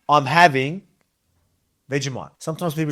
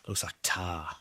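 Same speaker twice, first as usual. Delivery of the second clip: quiet disgust; 8 semitones lower, 15 dB quieter, slower than usual. Real, not acted. Disgusted at thick spread.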